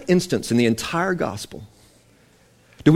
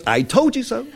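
playful Jesus voice; 8 semitones higher than usual. Retold or imitated. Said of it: "he goes".

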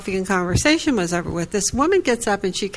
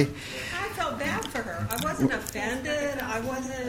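much softer, almost under the breath; quiet and sincere; 6 semitones higher, 10 dB quieter than usual. Real, not acted. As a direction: confess it gently, as if to yourself. Sincere admission about the offended lady.

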